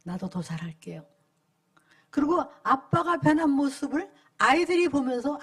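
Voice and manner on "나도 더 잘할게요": moved, tender. Retold or imitated. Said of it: "굉장히 감동이에요".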